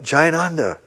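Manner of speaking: mild re-enacted yell of a name, falling at the end; retold yell. Calling to a sick friend down the hallway, mild retold yell. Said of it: "I yelled out".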